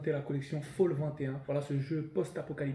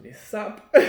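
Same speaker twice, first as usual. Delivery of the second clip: high, louder comic repeat; amused disbelief; 6 semitones higher, 5 dB louder than usual. Real, not acted. Host on the game's clothes, amused disbelief. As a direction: pitch it up, about to burst out laughing.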